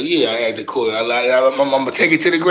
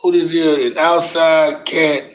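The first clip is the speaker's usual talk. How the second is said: normal talking voice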